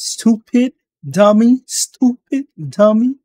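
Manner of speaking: insults said in a mocking, high French accent. Mocking the customer's insults in his French accent. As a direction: sneer "stupid" and "dummy" in a French accent. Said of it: "with that fucking French accent".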